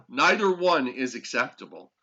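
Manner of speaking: ordinary delivery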